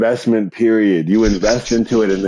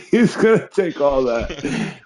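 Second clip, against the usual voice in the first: higher voice breaking into laughs; laughing tease; 7 semitones higher than usual. Real, not acted. Host teasing over an innuendo, laughing as he speaks. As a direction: repeat it through giggles, tickled.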